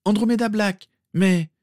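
put-on high-pitched eager girl; jeune fille empressée. Girl introduces herself, is cut off. (petite voix aiguë et empressée)

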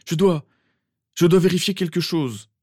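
high, urgent, stumbling insistence; anxieux. Acted. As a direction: stumble over the first words with anxiety. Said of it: "semblait anxieux". (insistance aiguë et anxieuse)

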